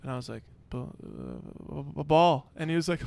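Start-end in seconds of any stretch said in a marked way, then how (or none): 0.7-2.5 stammered fake sleep-talk, louder on "ball"; pretend sleep talking. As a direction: fumble for the word as if asleep, then push it out. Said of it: "you're talking in your sleep".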